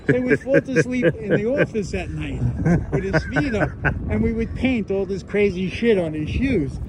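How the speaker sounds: loud, animated storytelling. Real, not acted. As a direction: tell it loud and excited. Excited, loud telling of prank.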